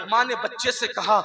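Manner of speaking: ordinary talk, nothing marked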